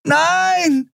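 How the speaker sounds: very high, desperate scream. A narrator's reading. Andriel being carried away from Kai, screaming. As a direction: scream it in refusal. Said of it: "schrie Andriel".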